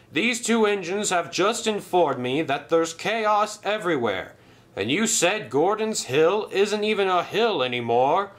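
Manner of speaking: high, hammed-up alarm; feigned dismay. Sir Topham Hatt fools Sailor John, mock dismay. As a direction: pretend to be ruined.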